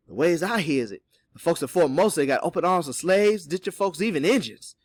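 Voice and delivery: animated character telling what he heard; knowing Cato. Acted. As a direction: give directions in a hearty dialect voice. Voices Cato answering.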